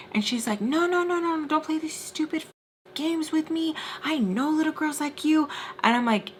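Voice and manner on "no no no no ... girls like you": high-pitched mock tirade; retold angry accusation. Drunk friend accusing the teller, high-pitched mock indignation. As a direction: imitate her cutting him off, pitch up.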